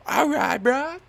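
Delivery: high, loud laid-back dude; imitating the instructor. Instructor egging on the jumper, in a high loud voice. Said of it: "And he's like".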